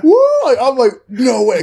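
high, loud excited disbelief; amazed whoop. Retold or imitated. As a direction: whoop and marvel at what just happened. Reacting to the coach's threat.

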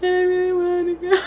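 high, wobbly mock sob, half-laughing; mock crying to dad. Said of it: "I called my dad crying".

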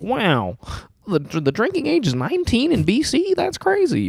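high-pitched retold astonishment; mock amazement. Telling a friend about the liquor store, in mock amazement.

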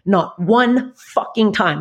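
very slow, every word weighed; slow emphatic. Real, not acted. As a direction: drag each word out. Bitter emphasis.

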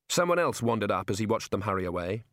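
plain reading voice

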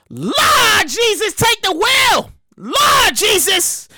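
drawn-out shouted plea to Jesus; exasperated cry. Real, not acted. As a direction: cry out to the Lord, stretching each word. Mock exclamation at drunk story.

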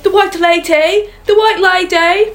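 mock British child's cry, high and loud; British accent. Redoing the boy's cry, now in a British accent. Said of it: "he has a British accent".

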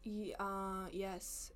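soft hesitant yes; timid yes. Retold or imitated. A teacher recognizes her, timid soft yes. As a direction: let it trail out shyly.

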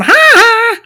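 high, loud two-note "ha ha"; Simpsons character's taunt. Retold or imitated. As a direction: imitate a cartoon bully's mocking laugh. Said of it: "pointing at different places going".

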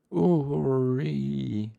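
a simple man calling out. Acted. Tenny, a mute man, calling out.

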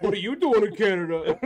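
put-on Canadian accent. Co-host repeating the border quote, loud Canadian accent. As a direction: belt it in a mock Canadian accent. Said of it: "All I can ever think with Canadian accents".

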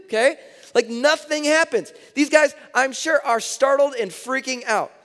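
preaching at full force. Acted. Preaching at high energy.

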